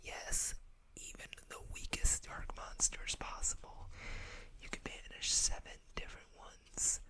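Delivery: usual speaking voice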